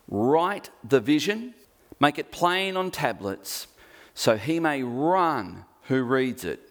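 solemn preaching read; scripture reading. Acted. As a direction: declare it like God speaking. Reads God's answer from scripture.

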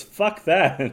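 imitating a partner's blunt dismissal. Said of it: "and he goes".

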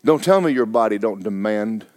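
ordinary talk

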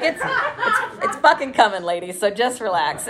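laughing, high mock pep talk; cheerfully overconfident pep talk; hammed-up reassurance. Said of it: "I was like".

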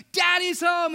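high, loud mock child's shout; imitating an overjoyed daughter greeting dad; Esther. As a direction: shout it like a thrilled little girl. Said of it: "she goes".

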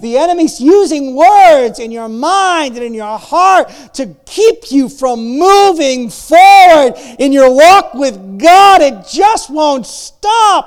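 loud, slow, driving preacher cry; fervent preaching. Acted. Pastor urging the congregation forward, full-force preaching. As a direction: hammer it home to the congregation.

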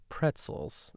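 soft, breathy "Pretzels" with no inflection; mild imitation of a dull reply; flat deadpan. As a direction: echo the word as if unimpressed. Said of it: "That's exactly how she said it".

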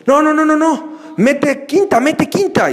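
high, urgent cry of no, no, no; a passenger panicking as the car slips back; panicked friend. Retold or imitated. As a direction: copilot frantically telling the driver to shift. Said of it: "te dice".

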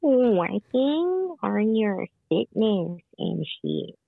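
slow, drawn-out repeat at normal volume; talking up. Real, not acted. Repeating for a caller who asked to talk up, slow and clear. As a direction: spell it out slowly for someone who can't hear. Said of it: "Can you talk up?".

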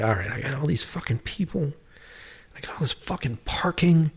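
exasperated whisper. Retold or imitated. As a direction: grumble it to yourself, fed up. Grumbling to himself about producing.

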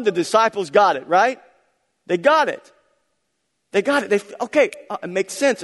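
loud, high, driving preacher voice; rising sermon fervour; full-force preaching. Acted. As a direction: preach it out to the whole room.

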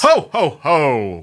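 playful hint of Santa Claus; Santa laugh. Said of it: "that's right, Santa".

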